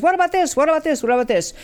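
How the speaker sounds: each repeat starting high and sinking back down; pestering viewers. Retold or imitated. Voicing viewers pestering her with suggestions, eager then fading. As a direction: mimic eager people firing suggestions, cooling off. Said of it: "I had people saying".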